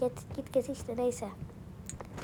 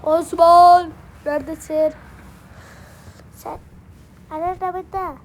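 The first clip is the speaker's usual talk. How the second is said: calling out; 4 semitones higher, 12 dB louder than usual. Real, not acted. Calling out for the baby.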